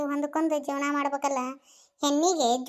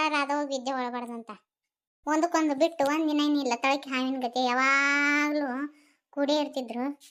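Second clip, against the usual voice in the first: ordinary talk, nothing marked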